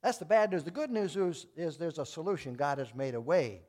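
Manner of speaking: preacher building to a climax; full-force preaching. Acted. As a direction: drive home the point from the pulpit.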